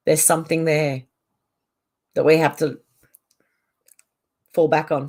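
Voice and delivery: talking with cream in mouth; words round a mouthful of cream; mouth full. Real, not acted. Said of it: "Sorry, I've got cream in my mouth".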